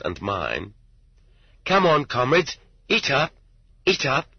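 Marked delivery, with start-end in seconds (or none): 1.6-4.3 high, smiling offer to share food; shy prisoner sharing his mother's potatoes; shy invitation. A narrator's reading. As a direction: gently urge them to eat. He used to smile shyly.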